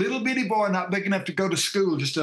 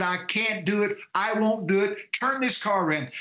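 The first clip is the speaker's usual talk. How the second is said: normal talking voice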